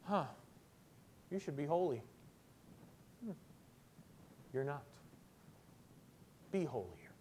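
low, quiet, unimpressed mutter with long pauses; mock offhand exhortation. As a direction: toss the command out with no energy. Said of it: "come out this morning and just go".

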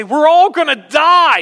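loud, high cry of warning; acted alarm shout. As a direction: raise the alarm as if the place is burning. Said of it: "lift our voice is to get your attention".

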